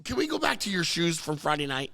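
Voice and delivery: ordinary talk, nothing marked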